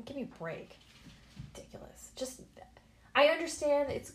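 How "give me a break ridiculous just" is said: breathy whispered dismissal; whispered scoff. Real, not acted. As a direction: whisper it with disdain. Dismissing mask worries.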